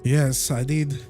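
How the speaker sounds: ordinary talk, nothing marked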